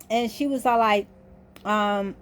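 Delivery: ordinary talk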